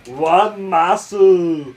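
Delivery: loud, slow, high meathead bellow; bodybuilder shout. Acted.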